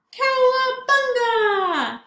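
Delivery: high, loud, slow surfer whoop; cowabunga cry. Acted. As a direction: stretch it like riding a wave. Surfing fun in story.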